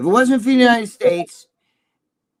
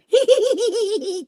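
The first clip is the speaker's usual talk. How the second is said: high burst of laughter. Real, not acted. Host mocking online bodybuilders, real high laugh.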